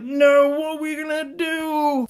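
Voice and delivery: very high, slow wailing mock despair; hammed-up squeal of panic. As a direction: wail it like a cartoon in distress. Rangers lose the remote.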